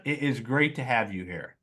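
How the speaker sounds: ordinary delivery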